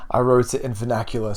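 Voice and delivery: usual speaking voice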